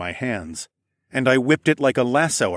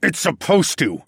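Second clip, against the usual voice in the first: raised, gruff retort; grunted retort; 7 semitones higher, 4 dB louder than usual. A narrator's reading. Dragon answers the burning assassin, grunting. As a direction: grunt it without sympathy. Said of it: "I grunted".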